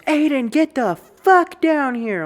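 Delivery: high, furious shout of an angry mother; screaming mom. Retold or imitated. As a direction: yell it down the stairs like a livid mom. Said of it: "my mom screams".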